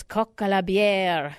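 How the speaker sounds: coq à la bière with a light French accent, through a stuffed nose; mock-French coq à la bière, said with relish. Shown as a demonstration. Radio host hams up the dish name in a light French accent. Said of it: "I'm going with my French accent here".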